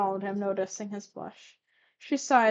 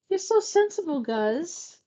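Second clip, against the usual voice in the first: put-on high, louder, girlish lilt; mildly giggly, high-pitched praise; 8 semitones higher, 5 dB louder, slower than usual. A narrator's reading. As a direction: coo it high with a touch of giggle. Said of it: "Lusamine giggled".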